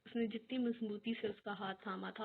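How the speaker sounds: usual speaking voice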